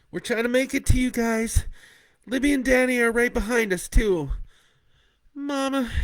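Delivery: high, loud daughter on the phone; daughter's voice. Acted. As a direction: Kat telling her parents from the shelter, high and loud. Kat on the phone.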